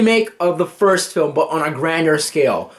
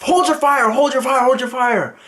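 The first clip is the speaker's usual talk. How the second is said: commanding shout; 7 semitones higher than usual. Retold or imitated. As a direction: bark the order to cease fire.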